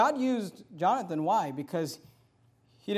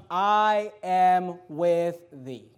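slow pledge; 7 dB louder, much slower than usual. Acted. Armor bearer's pledge, slow.

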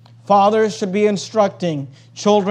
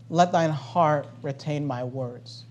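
much softer and lower, slowed; soft slow quote; 7 semitones lower, 12 dB quieter, slower than usual. Acted. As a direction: murmur the verse gently. Quotes the verse again.

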